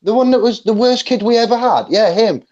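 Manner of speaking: high, incredulous teacher voice; imitating shocked teachers, pitch up; mock disbelief. Teachers hearing about David, stunned and high.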